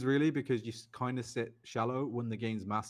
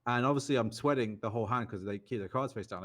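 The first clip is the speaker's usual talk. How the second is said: normal talking voice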